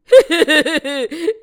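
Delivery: loud, drawn-out villainous chuckle; gloating cackle. A narrator's reading. Trishanku cackles while reaching towards heaven, gloating cackle. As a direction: cackle with greedy glee.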